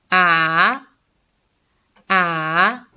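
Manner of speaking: long "a" dipping low, then up; third, dipping tone. Shown as a demonstration. Teacher reads syllables for learners, dipping "a". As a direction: bend "a" down and back up. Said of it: "attention to the tones".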